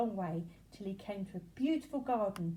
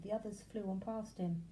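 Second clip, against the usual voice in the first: plain reading voice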